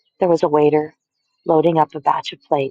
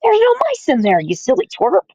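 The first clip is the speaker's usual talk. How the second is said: mocking shout; 8 semitones higher than usual. A narrator's reading. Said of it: "now they were shouting".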